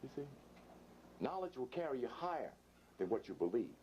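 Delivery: usual speaking voice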